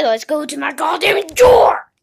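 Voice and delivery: loud, breathy whispered yell on drawer; hiss-shout it in frustration; a furious shouted whisper; angry whisper-shout. Acted. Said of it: "I said".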